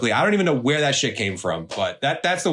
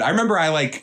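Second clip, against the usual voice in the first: normal talking voice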